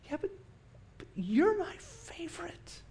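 high, slow, tender whisper of love. Pretend. God telling each listener they are favorite, tender and slow. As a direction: linger on it like a loving secret.

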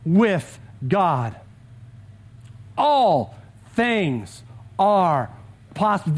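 slow, with long pauses between words; slow proclamation. Shown as a demonstration. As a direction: let every word land, pause before "possible". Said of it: "The first one is this".

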